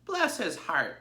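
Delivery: fond sheep crying out. Acted. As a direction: coo over the little pig. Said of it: "they cried".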